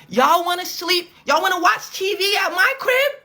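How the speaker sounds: high, loud yelled offer; mock shouting. [name] yelling at confused family.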